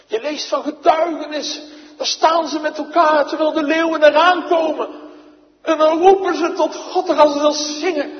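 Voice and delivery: loud, high, ringing declamation; full-force preaching. Acted. Preacher recounts martyrs singing before lions, loud and stirring. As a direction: rouse the congregation with the martyrs.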